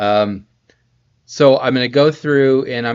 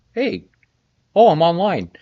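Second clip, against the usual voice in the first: higher-pitched pretend surprise; mock surprise; 7 semitones higher, 5 dB louder than usual. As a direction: play at just noticing you're live.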